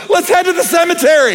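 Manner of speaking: put-on high-pitched scared teen voice; mock panicky teen whisper-shout; horror movie teen. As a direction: squeak it like a nervous teen in a slasher film. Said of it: "in the commercial is".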